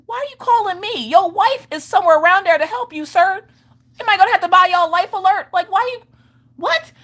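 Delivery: very high-pitched comic disbelief; mock outraged high voice; exasperated squawk. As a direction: pitch it way up, indignant and baffled. Exasperated inner reaction to dad's call.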